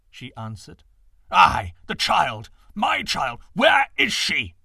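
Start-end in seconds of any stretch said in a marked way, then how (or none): 1.3-4.6 loud, slow, desperate shout; agonized cry. A narrator's reading. Frantic father shouts at his wife for his daughter, anguished. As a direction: cry out in agony for his child. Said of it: "he cried in agony".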